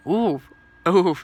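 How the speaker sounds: ordinary talk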